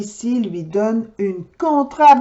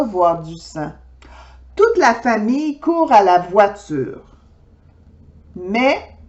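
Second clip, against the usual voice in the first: usual speaking voice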